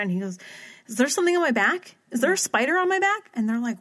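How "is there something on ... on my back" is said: high, panicky imitated yelp; freaking out. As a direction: pitch it up like he's spooked by a spider. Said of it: "Ryan just starts freaking out".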